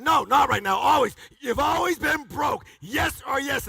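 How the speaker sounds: loud, high shouted comeback; shouted retort. Retold or imitated. Seminar host yelling at a broke attendee. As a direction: hammer it home at a yell.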